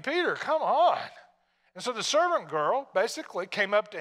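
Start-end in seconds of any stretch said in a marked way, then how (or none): 0.0-1.0 incredulous, louder come on; a loud, exasperated come on. Real, not acted. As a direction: scold him with disbelief. Preacher chiding Peter's weakness.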